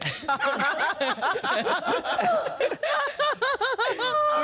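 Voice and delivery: helpless high laughter; cracking up, can't stop; long laughing fit. Real, not acted.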